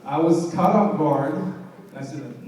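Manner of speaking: usual speaking voice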